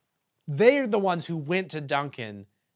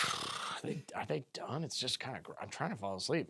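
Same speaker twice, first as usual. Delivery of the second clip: sleepy murmur; 3 semitones lower, 12 dB quieter than usual. Retold or imitated. Voicing sleepy kids, sighing.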